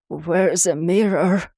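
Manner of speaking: slow, strange monster growl. A narrator's reading. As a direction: growl it out like a sea beast. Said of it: "I growled, not recognizing my own voice".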